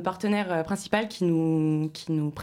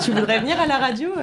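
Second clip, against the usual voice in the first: en riant; 8 semitones higher, 7 dB louder than usual. Real, not acted. Taquinerie entre animateurs en riant.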